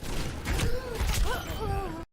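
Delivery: bursts out laughing at the replay; a genuine, helpless laugh; real laugh. Real, not acted.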